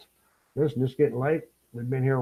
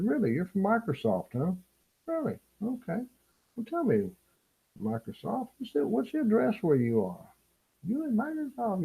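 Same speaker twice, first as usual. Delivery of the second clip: sarcastic mock disbelief; 11 semitones higher, 6 dB quieter than usual. Sarcastic retort to phone scammer.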